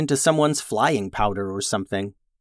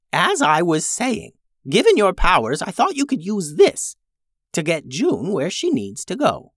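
a lightly voiced old witch; 7 semitones higher than usual. Tubes the old witch speaks.

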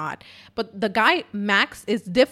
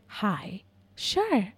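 very calm operator. Retold or imitated. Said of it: "very calmly".

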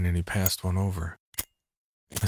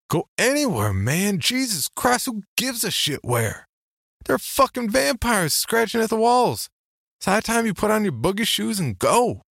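very high-pitched, louder frantic disbelief; worked-up, incredulous near-yell; exasperated outburst; 5 dB louder than usual. Acted. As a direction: burst out as if it were blindingly obvious.

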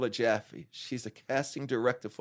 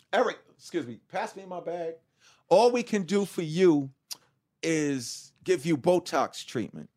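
doctor voice; 4 semitones higher than usual. Retold or imitated. Doctor voiced breaking bad news.

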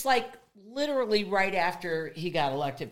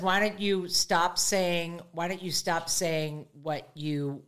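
slow quote; 4 semitones lower, 3 dB quieter, slower than usual. Retold or imitated. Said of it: "He says".

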